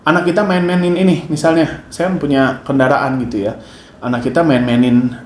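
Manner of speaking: ordinary delivery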